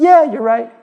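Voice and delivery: imitating a good sport giving in; amused concession. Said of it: "Imam Zuhri laughed".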